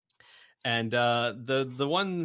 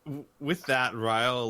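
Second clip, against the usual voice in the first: ordinary talk, nothing marked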